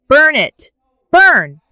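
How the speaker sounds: loud, high rabble-rousing shout; yelling. A narrator's reading. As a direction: yell it like an uncle egging on the crowd. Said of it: "one uncle yelling".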